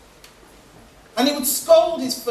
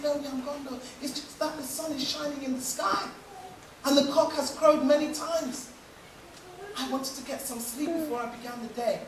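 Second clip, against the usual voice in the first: soft patient explanation; 3 semitones higher, 8 dB quieter than usual. Acted. Wife explains softly.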